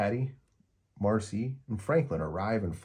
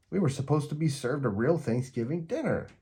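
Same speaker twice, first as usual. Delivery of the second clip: indignant; 7 semitones higher than usual. A narrator's reading. Patty's complaint continues.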